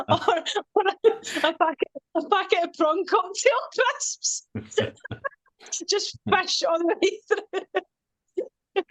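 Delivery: laughing. Real, not acted. Joking, laughing through the idea.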